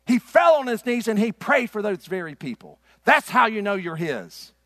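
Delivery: loud, high-pitched pulpit challenge; preaching. Real, not acted. Preacher challenges his congregation over church dropouts, preaching loudly.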